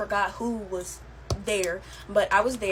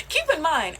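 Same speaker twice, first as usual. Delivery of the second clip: laughing; 4 dB louder than usual. Real, not acted. Laughs at her own story.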